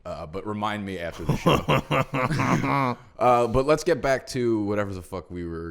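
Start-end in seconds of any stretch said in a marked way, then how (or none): 1.6-3.0 laughing. Real, not acted. Laughs at own secret.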